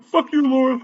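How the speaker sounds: very high, giggly mock jeer; laughing taunt. High and laughing about cursing the manager, giggly taunt. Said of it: "I'm laughing about it".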